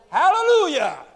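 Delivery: Hallelujah bellowed high and very loud; shouted Hallelujah. Acted. Preacher voicing the heavenly multitude, full shout. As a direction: roar it out like a multitude. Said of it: "they were all shouting".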